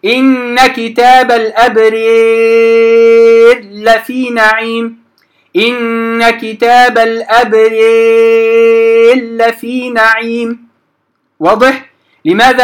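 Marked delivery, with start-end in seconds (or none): 0.1-5.0 slow إن كتاب الأبرير لفي نعيم, ra merged into lam; slow recitation of إن كتاب الأبرير لفي نعيم; merged ra demo. Shown as a demonstration. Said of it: "أدغمت الثانية". 5.6-10.7 slow إن كتاب الأبرير لفي نعيم, ra merged into lam; slow recitation of إن كتاب الأبرير لفي نعيم; merged ra demo. Shown as a demonstration. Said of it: "أدغمت الثانية".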